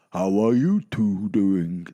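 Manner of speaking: booming slow Santa question; Father Christmas checking on passengers. Acted. Santa asks over the noise.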